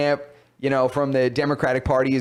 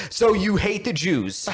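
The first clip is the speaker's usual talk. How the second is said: mock accusing moderator; 6 semitones higher, slower than usual. Moderator voiced, accusing candidate.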